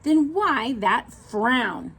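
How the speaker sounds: drawn out, slow question; slow, curious snake. Acted. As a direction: let the snake take its time. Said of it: "said Snake".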